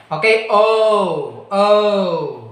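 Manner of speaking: slow "o" gliding into u; drawn-out "o" for learners; diphthong demo. Shown as a demonstration. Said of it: "this one is a diphthong".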